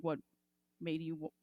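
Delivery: usual speaking voice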